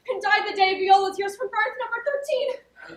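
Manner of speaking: breathlessly eager, pitch climbing to the end; rising excitement. Acted. Sister proving her identity to her lost brother, rising excitement.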